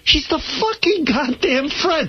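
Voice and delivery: high, drawn-out livid outburst. Retold or imitated. Radio guest reliving his rage at Gayle King's entrance, livid. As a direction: hammer out each word, high-pitched and incensed. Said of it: "This is how angry you were".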